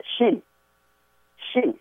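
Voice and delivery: Shin with a soft sh sound; Shin, sh then a short i; Chinese pronunciation. Shown as a demonstration. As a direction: say Shin slowly, with a hushed sh. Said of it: "nó đọc là".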